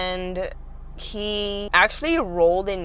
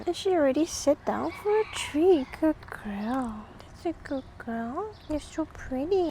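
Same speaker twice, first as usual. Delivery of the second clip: soft, higher cooing praise; talking to a dog; 5 semitones higher, 7 dB quieter than usual. Real, not acted. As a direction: murmur sweetly to a calm dog.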